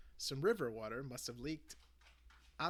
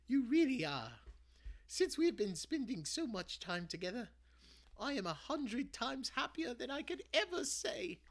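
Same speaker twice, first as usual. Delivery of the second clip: higher, louder loving grandmother's voice; reassuring granny; 12 semitones higher, 8 dB louder than usual. Acted. Granny hugging her grandson and reassuring him, tender. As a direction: comfort him like a proud granny.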